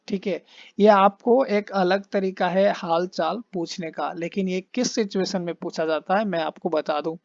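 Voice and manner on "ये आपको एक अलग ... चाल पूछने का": normal talking voice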